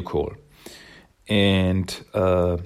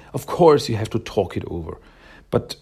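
usual speaking voice

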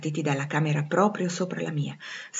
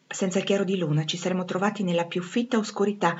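plain reading voice

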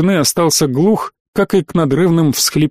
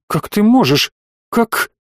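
higher, the voice cracking on the last word; a wife's plea giving way; breaking voice; 5 semitones higher than usual. A narrator's reading. Wife to the husband doubting their son, voice breaking. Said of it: "Голос её сорвался".

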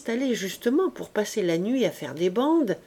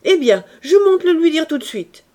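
loud, high angry threat; furious threat; 8 semitones higher, 10 dB louder than usual. A narrator's reading. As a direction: storm off shouting. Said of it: "cria le mari furieux".

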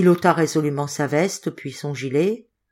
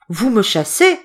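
raised, louder appeal in disbelief; dismayed question; 4 semitones higher, 5 dB louder than usual. A narrator's reading. Boy learning he is dismissed, dismayed question. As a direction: ask it aghast, pitched up. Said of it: "en levant les mains au ciel".

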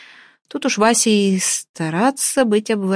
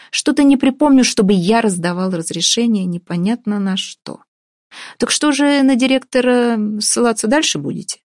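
prosecutor's voice; 5 semitones higher, 3 dB louder than usual. Acted. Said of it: "прокурорским голосом".

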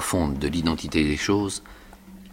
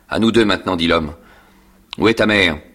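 louder stern challenge; gruff confrontation; 6 dB louder than usual. Acted. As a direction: square up to him. Convict confronting boy.

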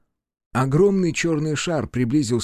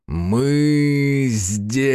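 demon voice; 3 dB louder than usual. Acted. Demon calling from the sky.

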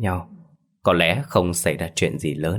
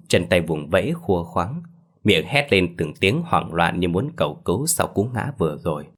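ordinary delivery

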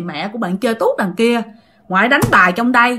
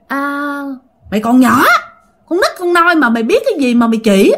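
grandmother's loud, high-pitched telling-off; mock-angry outburst, louder and higher; retold scolding; 5 semitones higher, 4 dB louder than usual. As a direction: scold a child in a quick, raised voice. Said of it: "Tự nhiên bà ngoại xoay qua chửi mẹ của bạn".

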